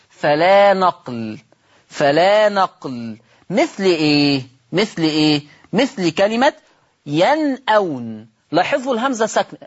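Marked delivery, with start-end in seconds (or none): 0.1-3.2 فلا نقل said slowly and firmly, with stress on فلا; slow emphatic ruling. Shown as a demonstration. Teacher stressing the rule to students, slow and firm. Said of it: "نعمل نقل ولا ما نعملش". 7.1-8.3 ينأون said slowly with the hamza kept, yan'awn; without transfer. Shown as a demonstration. Teacher demonstrating a word with no transfer, hamza kept. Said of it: "قلت ينأون".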